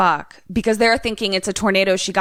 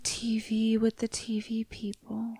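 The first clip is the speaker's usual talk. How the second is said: slow soft whisper; 8 dB quieter, slower than usual. Real, not acted. Friend joining the spooky reveal in a slow whisper. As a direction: breathe it out slowly in awe. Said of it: "friend whispers reveal".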